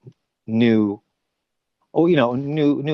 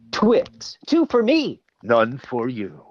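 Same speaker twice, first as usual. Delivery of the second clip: Twix ad voice; 3 semitones higher, slower than usual. Retold or imitated. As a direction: tease like a candy ad. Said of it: "I did this commercial and it was".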